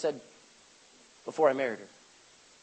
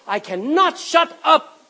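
higher, louder, slow and emphatic; passionate insistence; 7 semitones higher, 5 dB louder, slower than usual. Acted. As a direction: declare it word by word, refusing silence. Voicing passionate faith.

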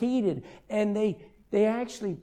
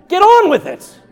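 sudden high, very loud outburst; exasperated shout; 10 semitones higher, 15 dB louder than usual. Acted. Pastor interrupts an endless greeting, exasperated shout. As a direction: cut them off with a yell.

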